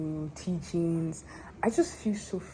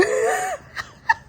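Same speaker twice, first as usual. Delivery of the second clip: real high-pitched giggle burst; high laugh; 3 dB louder than usual. Real, not acted. Vlogger cracking up at the word underdog, high laugh.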